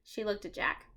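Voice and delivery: plain reading voice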